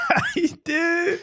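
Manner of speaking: very high, drawn-out echo. Retold or imitated. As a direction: stretch it way up high in imitation. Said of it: "And his catchphrase one more time".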